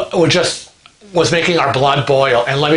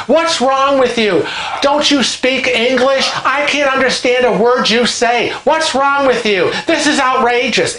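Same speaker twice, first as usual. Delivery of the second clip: retold railing; 8 semitones higher than usual. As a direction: scold the waiter as if he can't understand. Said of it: "And she's railing".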